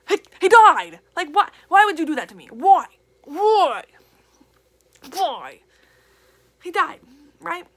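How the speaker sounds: real upset outcry over a beloved character dying; distressed wailing why. Real, not acted. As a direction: wail each why in grief. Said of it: "It's really emotional".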